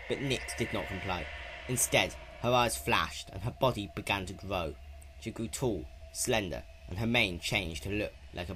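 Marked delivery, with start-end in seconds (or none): none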